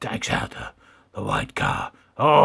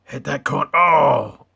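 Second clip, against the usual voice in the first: normal talking voice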